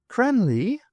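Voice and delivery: high, questioning call of a name; high-voiced query from an acquaintance; smooth, familiar voice. A narrator's reading. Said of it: "smooth and familiar".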